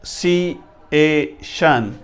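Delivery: very slow पर नन, सी, ए, शन with gaps between syllables; teacher sounding out each piece slowly; syllable-by-syllable demo. Shown as a demonstration. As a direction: break the word into separate, drawn-out syllables. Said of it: "आपने इसे किस तरह पढ़ना".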